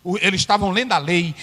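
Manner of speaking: ordinary talk, nothing marked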